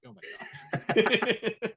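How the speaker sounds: very high, drawn-out mock dismay; comic high wail with a laugh. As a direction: react in exaggerated high-pitched disbelief.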